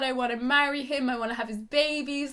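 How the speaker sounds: ordinary talk, nothing marked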